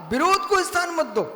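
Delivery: high, quick, urgent maxim; emphatic exhortation. Real, not acted. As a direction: press the warning home in a high voice. Maxim raised, then repeated calmly.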